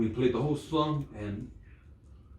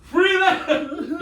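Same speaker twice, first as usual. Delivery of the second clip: mock battle cry; 13 semitones higher, 7 dB louder than usual. Braveheart battle cry parody.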